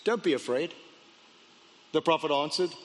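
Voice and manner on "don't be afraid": low, calm reassurance; steady and unruffled, pitched down. A narrator's reading. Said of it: "calmly says".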